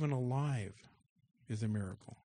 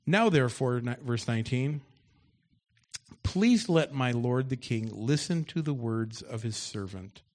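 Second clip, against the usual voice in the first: loud preaching read of the story; loud scripture reading; 6 dB louder than usual. Acted. Pastor reads David's taunt aloud to the congregation, loud and forceful. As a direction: boom the scripture out to the church.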